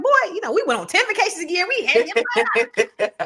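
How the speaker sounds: high-pitched comic protest at a son; mock indignant mom.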